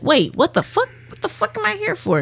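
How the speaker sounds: half-hearted bewildered disbelief; confused outrage. Retold or imitated. Dead man confused in waiting room.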